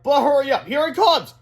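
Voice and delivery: high, loud hurrying call; urgent warning. A narrator's reading. Boy warns superhero of the monster, urgent and loud. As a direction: call it out in alarm.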